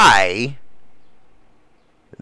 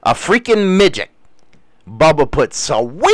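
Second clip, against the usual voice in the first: usual speaking voice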